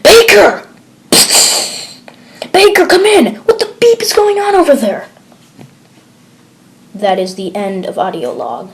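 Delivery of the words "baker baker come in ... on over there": a high, loud frantic call; alarmed shout. Acted. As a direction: scream his name down the line. HQ loses contact suddenly.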